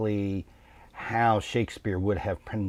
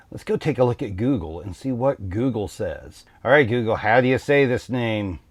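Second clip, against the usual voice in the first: ordinary delivery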